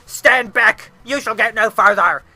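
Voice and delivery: loud, theatrical guardian monster; commanding monster. Acted. Dracula's guard ordering heroes back, loud commanding monster voice. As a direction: boom out the warning.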